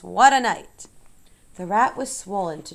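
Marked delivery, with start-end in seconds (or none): 0.0-0.9 loud, hoarse "What a night!"; hoarse exclamation. Acted. Rat back from a night of feasting, hoarse and loud. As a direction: bark it out rough and spent. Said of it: "he repeated hoarsely".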